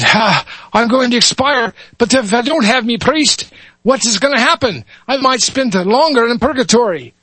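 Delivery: high, fretful Irish brogue; Irish lad. Acted. Dying lad frets about purgatory, fretful Irish accent. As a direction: worry aloud in a young Irish voice. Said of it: "switch between dialects here".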